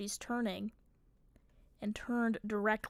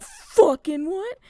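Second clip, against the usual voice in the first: high, very loud, drawn-out disbelief; exasperated, stretched-out yell; retold shocked yell; 7 semitones higher, 14 dB louder, much slower than usual. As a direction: shout it slowly in stunned outrage. Retold reaction to grandma's sudden move.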